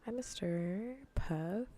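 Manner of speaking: usual speaking voice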